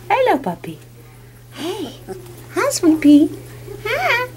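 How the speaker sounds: high, drawn-out cooing. Real, not acted. Owner coaxing a puppy to keep rolling, high baby talk.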